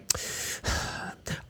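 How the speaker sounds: long whispered sigh of annoyance; an exasperated breathy sigh; weary sigh. Retold or imitated. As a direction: sigh like you're being interrupted. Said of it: "I was like".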